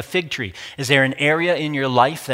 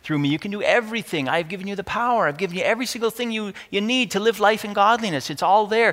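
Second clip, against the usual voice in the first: high-pitched earnest insistence; acted exasperated encouragement, pitched high; God pleading; 7 semitones higher than usual. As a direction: plead upward, urging someone to believe. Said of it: "God's like".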